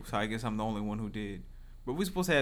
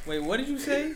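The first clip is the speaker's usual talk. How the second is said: excited high outburst; 12 semitones higher than usual. Real, not acted. Shocked outburst at friend's remark.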